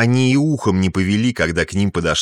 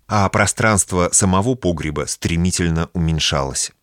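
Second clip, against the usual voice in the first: ordinary delivery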